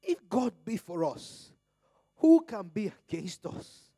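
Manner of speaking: slow, loud preaching read, building; fiery pulpit reading of Romans; preaching scripture. Acted. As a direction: proclaim each line of the verse to the congregation.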